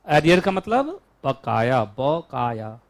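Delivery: slow, word by word; slow dictation. Shown as a demonstration. Teacher repeating the meaning for students to write, slowly. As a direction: spell it out slowly for note-taking. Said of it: "लिखो।".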